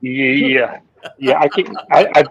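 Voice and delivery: usual speaking voice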